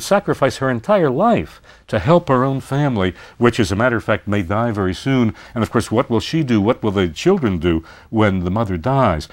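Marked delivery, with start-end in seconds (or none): none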